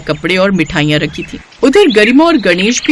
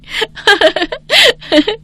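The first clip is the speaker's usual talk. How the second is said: high mocking giggle; smug high laugh; 12 semitones higher than usual. Spoilt bride laughs smugly after boasting, high giggle. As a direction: laugh at the poor ones.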